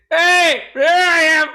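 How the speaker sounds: loud, high-pitched mock greeting; playful calling out. Man greets his own body, playful calling out. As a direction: call out as if spotting an old pal. Acting out spotting himself, calling out.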